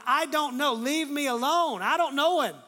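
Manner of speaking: loud, high, heated outburst; acted cursing denial. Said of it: "he's beginning, he's cursing".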